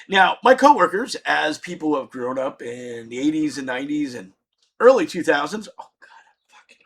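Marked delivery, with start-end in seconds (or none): 5.8-6.8 quick whispered self-mocking aside. Real, not acted. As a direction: drop to a whisper for the joke. Aside about his own age.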